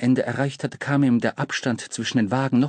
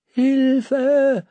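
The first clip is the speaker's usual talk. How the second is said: calling; 12 semitones higher, 4 dB louder than usual. Acted. Boy sinking in snow.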